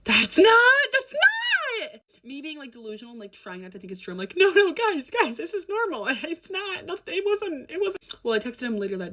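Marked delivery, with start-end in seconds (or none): 0.0-0.8 loud, much higher, rising in alarm; shocked protest. Retold or imitated. Friends' shocked reaction, building. 0.9-2.0 shouted far higher, falling hard on "not"; full shout. Retold or imitated. Friends' shocked reaction, peak. 4.4-8.0 much higher, anxious laughing denial; nervous denial. Retold or imitated. Her delusional denial, retold.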